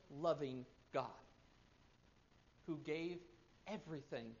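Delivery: soft, low preaching with weighty pauses; hushed, reverent sermon delivery; solemn preaching. Real, not acted. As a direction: drop low and let each phrase land softly.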